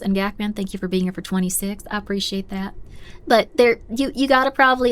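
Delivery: ordinary talk, nothing marked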